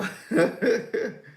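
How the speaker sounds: high burst of laughter; real laughter. Real, not acted. Shocked amusement at doom candle.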